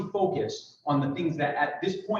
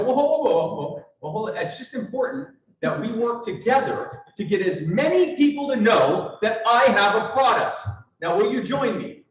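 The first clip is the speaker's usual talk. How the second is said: flustered salesman; 7 semitones higher, 6 dB louder than usual. Acted. Salesman stalls the customer.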